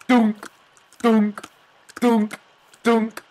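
high, evenly spaced comic "dunk" noises; silly repeated high thunks; imitated drunken bonking. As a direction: make cartoon bumps in a high voice. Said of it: "they're just like".

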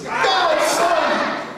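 high, loud call. Acted. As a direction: call it out to the sky. Call response.

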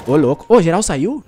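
loud, startled outcry; startled shout. Real, not acted. Streamer yelling in surprise at the empty lobby. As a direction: blurt it out in shock.

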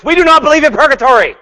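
high, loud, fast mock declaration; hammed-up insistent denial; mock website proclamation. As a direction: read it like a banner in all caps. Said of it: "They say on their websites".

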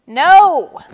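high, very loud burst; yelled. A narrator's reading. Vulture refusing Turtle the bracelet, yelling. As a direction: yell the refusal outright. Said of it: "yelled Vulture".

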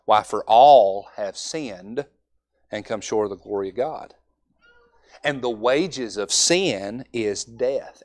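slow, weighty reading of scripture; preacher reading Romans slowly to a boy. Shown as a demonstration. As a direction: lay each word down slowly. He reads for him Romans.

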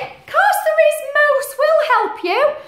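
put-on squeaky little kid welcoming a friend; high kids' voices. Ben and Bella welcoming Moose, high and loud. Children answer moose in story.

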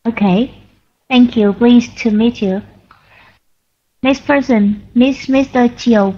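normal talking voice

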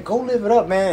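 high-pitched mock hype; sarcastic party cheerleading; mock encouragement. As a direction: egg them on, high and teasing. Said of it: "I was talking like this".

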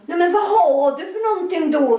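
high giggly demand; laughing question. Retold or imitated. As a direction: press her with amused curiosity. Pressing her with question.

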